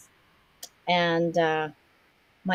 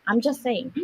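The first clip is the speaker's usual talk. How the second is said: normal talking voice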